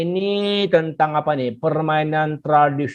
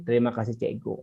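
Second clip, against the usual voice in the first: polite thanks; 8 semitones lower, 9 dB quieter, faster than usual. Shown as a demonstration. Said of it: "jangan lupa ucapkan".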